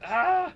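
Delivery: high loud exasperated "ah"; frustrated yell. Retold or imitated. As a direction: cry out in frustration.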